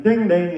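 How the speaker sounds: loud mimicked doorbell chime; loud mock ding ding. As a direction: ring it out like a doorbell. Imitates doorbell.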